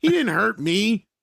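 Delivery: high, slow retold kid protest; going off. Young kid defending Ryan to Pat, going off. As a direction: stretch it out as an indignant kid. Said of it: "he's just going off on Pat".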